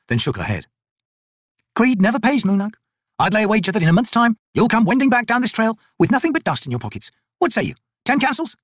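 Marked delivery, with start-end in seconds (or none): 1.7-8.6 higher, brisk soldier woman's voice; Picker, tough soldier. Acted. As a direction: voice the corporal, dry and sure of herself. Picker's voice in dialogue.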